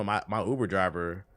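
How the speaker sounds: ordinary delivery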